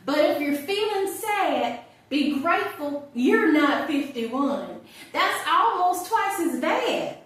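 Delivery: deliberate, lilting verse with punched rhymes; comic poem recital. Acted. Writer reading her poem on turning fifty, wry comic recital. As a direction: recite a darkly comic birthday rhyme with relish.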